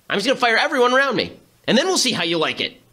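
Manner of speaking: high, fast, petulant mockery; lightly hammed-up hothead; mock petulant threat. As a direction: play him as a tantrum-throwing child. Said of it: "he's basically saying".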